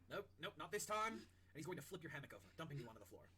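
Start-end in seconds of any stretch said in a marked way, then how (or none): 0.1-1.2 impatient chef. Acted. Chef refusing to let lizard sleep.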